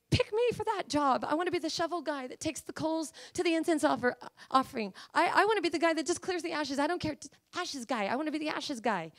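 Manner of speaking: high, eager begging to be picked; eager priest. Retold or imitated. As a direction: plead like a keen young priest for the job. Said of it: "The priest would, like".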